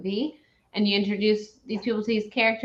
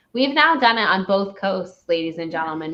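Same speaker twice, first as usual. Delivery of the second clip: ordinary delivery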